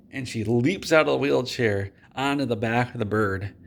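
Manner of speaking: ordinary talk, nothing marked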